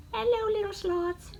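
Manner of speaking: high cutesy voice cooing at animals; squeaky cartoon voice. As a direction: talk to it like a tiny pet. Greeting sloths on fabric.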